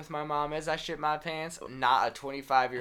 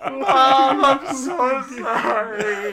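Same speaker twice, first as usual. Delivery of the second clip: high, slow, breaking into laughs; laughing apology; 10 semitones higher, 10 dB louder, much slower than usual. Real, not acted. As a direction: apologize while cracking up. Laughing through the retold apology.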